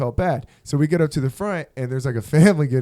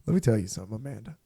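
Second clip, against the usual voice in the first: dead serious; 5 semitones lower, 6 dB quieter than usual. Retold or imitated. Dead-serious confession to Amanda.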